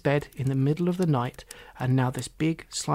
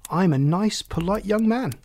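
put-on higher, louder, self-important boy; proud; 6 semitones higher, 5 dB louder than usual. Boy defends his good name to the frog, proud. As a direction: assert it proudly, pitch climbing. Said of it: "puffed his chest out proudly".

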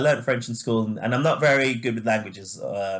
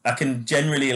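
usual speaking voice

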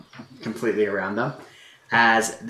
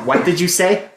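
louder, higher challenge; demanding a repeat; 7 semitones higher, 7 dB louder than usual. Acted. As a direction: raise your voice and ask pointedly. Character demands repeat of muttered remark.

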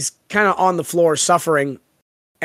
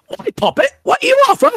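higher, hammed-up Cockney, shouting the offer; bad Cockney fairy. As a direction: cackle out a terrible Cockney fairy bargain. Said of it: "worst version of a Cockney".

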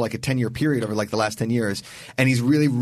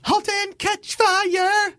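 grand announcement; much slower than usual. Pretend. Grand reveal of show title.

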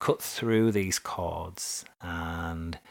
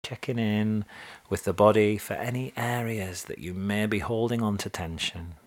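guided meditation; 2 semitones higher than usual. Acted. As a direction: gently guide them into relaxation.

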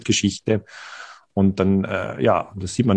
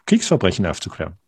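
ordinary talk, nothing marked

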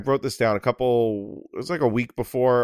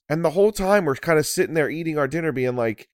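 usual speaking voice

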